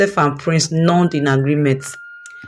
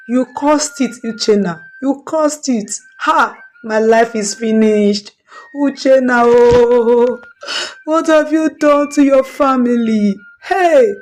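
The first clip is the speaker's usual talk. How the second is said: high, wailing accusation through tears; loud crying; 6 semitones higher than usual. Acted. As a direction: cry it out at your husband, voice breaking high. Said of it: "Missus Ife is crying loudly".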